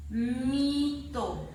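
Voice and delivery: "mito" with MI long and strong; drawn-out "mito", force on MI; stressed syllable demo. Shown as a demonstration. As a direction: hold MI, then drop on the end of "mito". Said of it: "dónde está la fuerza de voz".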